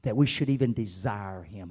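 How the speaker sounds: rising preacher's cry, then dropping away; preacher calling Isaiah out to the church, building to a shout; preaching. Acted. Preach it to the congregation, rising to a shout.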